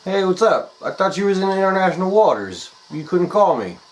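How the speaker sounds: high, loud fake-casual voice; nervous caller. Pet sitter on the phone to Jim, high fake-casual cover. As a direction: cover panic with a breezy tone.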